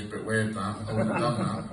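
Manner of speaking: dry little dig, a hint of a sarcastic boy; sarcastic son. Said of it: "in his little sarcastic voice".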